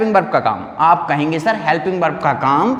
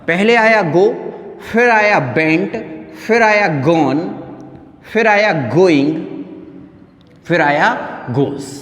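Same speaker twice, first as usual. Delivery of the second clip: slow listing; slower than usual. Shown as a demonstration. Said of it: "ध्यान सुनिएगा मैं आपको बताता हूँ".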